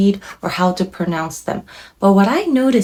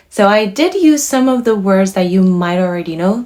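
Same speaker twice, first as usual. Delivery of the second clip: usual speaking voice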